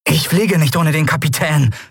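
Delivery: put-on resolute boy. Boy refusing to leave without the captain, lightly voiced resolve. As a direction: state it as a refusal.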